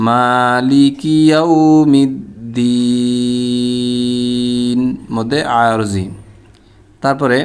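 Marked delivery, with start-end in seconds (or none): 0.1-4.8 slow মালিকি ইয়াউমিদ্দিন with very long final "deee"; connected recitation, last vowel drawn out; three-alif stretch at stop. Shown as a demonstration. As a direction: hold the end of ইয়াউমিদ্দিন, close on n. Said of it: "তাহলে কীভাবে পড়তে হবে".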